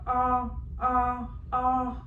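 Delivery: three short open ahh sounds; saying ahh. Shown as a demonstration. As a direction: say ah, ah, ah with mouth wide open. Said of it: "I want you to go".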